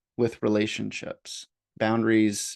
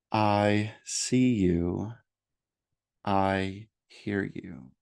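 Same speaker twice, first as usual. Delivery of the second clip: very slow, measured mantra with long pauses; drawn-out, spacious repetition; meditation mantra; much slower than usual. Shown as a demonstration. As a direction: offer each phrase slowly for the listener to practice. Models a meditation phrase.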